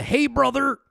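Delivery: a lightly done streamer bro voice; hey brother voice. Retold or imitated. Streamer mocking leftists, mock bro greeting. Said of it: "They all do the".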